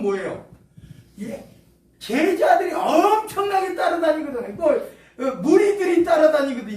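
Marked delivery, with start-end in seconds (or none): 2.0-6.8 louder, eager and laughing; excited emphasis. Real, not acted. Preacher to congregation, excited and laughing. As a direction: marvel at how many followed. Excited about crowds following.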